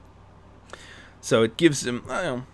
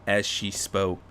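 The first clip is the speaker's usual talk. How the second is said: usual speaking voice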